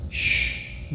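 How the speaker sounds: a sharp unvoiced shush. Nurse hushing the shouting father, sharp shush. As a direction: hush him with a hiss. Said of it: "तर nurse एकदम ओरडली".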